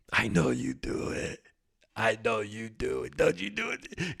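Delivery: put-on soft teasing baby talk, climbing in pitch; playful tickling. Host pretends to tickle the listener, soft teasing coo. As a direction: coax and tease, softly rising. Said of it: "This is me, like, kinda tickling you".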